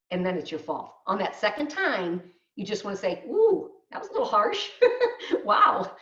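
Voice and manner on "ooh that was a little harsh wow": high, light "ooh" with a laugh; mock taken aback. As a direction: push back lightly, as if startled. Models reply to rude remark.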